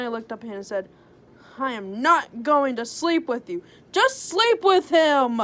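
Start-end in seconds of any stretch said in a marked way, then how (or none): 1.6-5.4 louder, rising defiant outburst; defiant shout. Acted. Heroine's defiant declaration.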